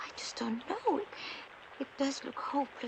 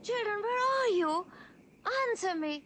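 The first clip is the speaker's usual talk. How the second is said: calling out; 7 semitones higher, 4 dB louder than usual. Acted. Mother calls for lost kittens.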